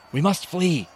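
high-pitched warning cry; urgent call. A narrator's reading. Calls to centurion amid the battle.

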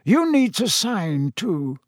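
slow, measured headmaster; Dumbledore. A narrator's reading. Headmaster stalls the executioner over paperwork, slow and measured. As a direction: remind him slowly and courteously. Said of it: "came Dumbledore's voice".

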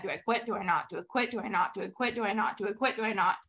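soft, murmured back and forth; inner debate. Retold or imitated. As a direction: mutter the doubt to yourself, softly. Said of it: "you can literally see her in her head".

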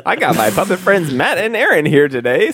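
put-on high bright kids' host intro; children's show host. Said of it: "we have little puppets pop up".

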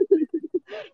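high, rising laugh; embarrassed little laugh. Real, not acted. Asked about her post.

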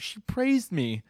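high, slow mock squeal; shocked delight. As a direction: act amazed at being praised. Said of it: "And she's so shocked".

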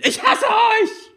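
put-on high, slow drawn-out teenage scream; high teen girl bellowing at her parents. A narrator's reading. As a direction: bellow it past him like a tantrum. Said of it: "brüllt sie wieder".

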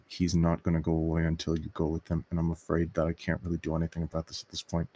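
low, soft confiding aside; whispered aside. Acted. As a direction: lean in and say it low. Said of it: "lean into Agave and say".

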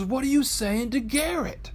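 high-pitched mock wifely questioning; imitated prying wife. Wife quizzes husband about his texting, high imitated voice. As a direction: impersonate a wife calling out a nosy question. Retold wife's repeated question.

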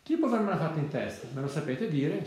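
usual speaking voice

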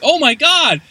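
loud, high-pitched exclamation of shock; mock shock. Host voices P-Nub seeing the corpse, hammed-up shock. Retold shock at dead body.

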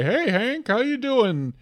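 high-pitched, friendly mock hello; neighborly greeting. Waving to neighbor Hank at sunrise, cheery mock greeting.

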